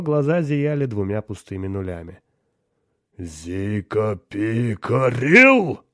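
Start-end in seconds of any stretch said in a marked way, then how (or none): 3.2-5.8 very slow, loud rising howl; demon howl. A narrator's reading. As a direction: howl it as a demon, stretching each sound. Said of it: "взвыл демон". (протяжный вой демона)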